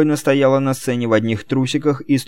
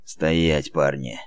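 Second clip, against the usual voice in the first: lower, softer grudging command; reluctant order; 8 semitones lower, 6 dB quieter, much slower than usual. Acted. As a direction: give the order unwillingly. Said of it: "нехотя отдал приказ".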